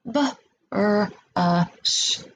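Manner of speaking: B, R, A, sh each said as a separate sound; sound-by-sound segmenting. Shown as a demonstration. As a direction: break brush into separate sounds. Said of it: "What are the sounds that make up the word brush?".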